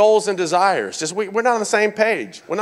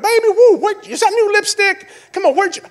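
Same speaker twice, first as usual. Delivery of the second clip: high-pitched, hammed-up gushing; gushing compliments; 12 semitones higher, 4 dB louder than usual. Husband flattering his wife about her lipstick, gushing. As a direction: fawn over her in an exaggerated high voice.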